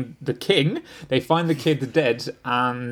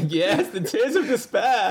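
gloating, higher cartoon villain voice; Cartman; 5 semitones higher than usual. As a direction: savour it with mock-evil relish. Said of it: "[name] is basically Cartman licking the tears".

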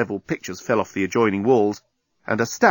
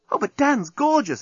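much higher, gushing; exclaiming. Acted. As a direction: blurt out her admiration. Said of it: "exclaimed Nettie".

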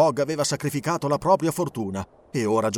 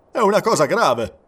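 gruff captain; 4 semitones higher, 7 dB louder than usual. Acted. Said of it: "osservò il capitano".

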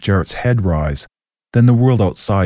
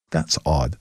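softer and lower, to herself; inner thought; 5 semitones lower, 7 dB quieter than usual. A narrator's reading. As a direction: think it quietly. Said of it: "she thought".

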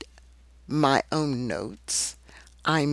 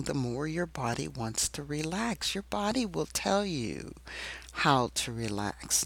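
right in your ear; 6 dB quieter than usual. Acted. Soft close meditation voice.

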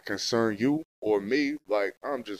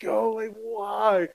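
exasperated plea; 10 semitones higher, much slower than usual. Retold or imitated. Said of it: "I'm like".

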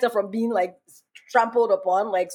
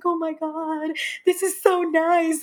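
high, gushing mock delight; imitating happy sister; 10 semitones higher, slower than usual. Sister at her first Caribana, imitated in high delight. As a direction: put on a thrilled high voice and stretch it. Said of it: "she was so happy".

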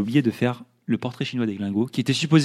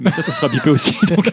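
usual speaking voice

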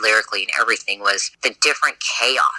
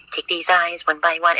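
higher creepy doll voice; 8 semitones higher than usual. Shown as a demonstration. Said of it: "it goes into the doll voice, which is".

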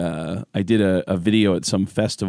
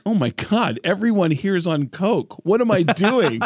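mock panic; 10 semitones higher than usual. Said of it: "I'm like".

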